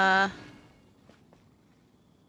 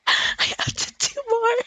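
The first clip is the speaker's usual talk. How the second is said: high, whiny half-sob, louder; high whimpering complaint, half laughing; whiny mock crying; 9 semitones higher, 3 dB louder than usual. Real, not acted. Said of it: "I'm gonna cry".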